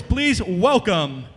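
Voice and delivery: slow booming ring announcer, name shouted; wrestling announcer. Pretend. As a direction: stretch and belt the name out. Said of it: "I'm gonna sound like a wrestling announcer".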